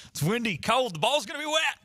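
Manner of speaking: bad Joey Football voice. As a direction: ham up a game-day weather forecaster without the Southern accent. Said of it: "That was a bad Joey Football, uh, um, voice".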